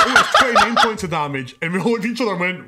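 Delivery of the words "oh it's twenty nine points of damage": retelling the DM's line through loud laughter; laughing correction. Retold or imitated. DM announcing damage to the dead party, laughing loudly.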